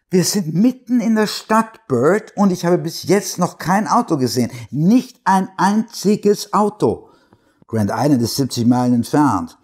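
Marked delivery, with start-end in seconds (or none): 0.1-7.1 alarmed. Acted. Wife panics about empty town.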